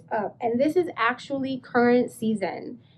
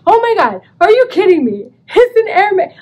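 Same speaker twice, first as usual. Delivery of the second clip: higher, louder disbelieving cry; thrilled; 9 semitones higher, 9 dB louder than usual. Retold or imitated. Discovering the Hermes tie in the hotel, a thrilled shout. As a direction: shout it with delight. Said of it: "I was like".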